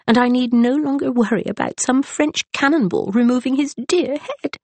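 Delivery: a fussy naval wife running on; chattering matron. A narrator's reading. Mrs Buckland chatters about husband.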